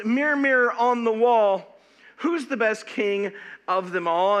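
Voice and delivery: loud, slowing on the last line; loud dramatic quote. Retold or imitated. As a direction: proclaim it like a challenge. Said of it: "literally it was".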